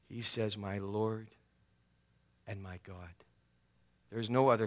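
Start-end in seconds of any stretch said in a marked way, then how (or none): 0.6-3.1 hushed awe. Retold or imitated. Thomas awed confession.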